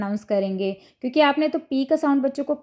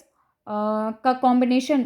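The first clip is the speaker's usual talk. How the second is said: usual speaking voice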